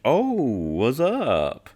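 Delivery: mock rapper ad-lib, very slow; drawn-out rapper ad-lib. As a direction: really draw it out and let the pitch swoop up. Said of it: "I'll do my best to match his cadence".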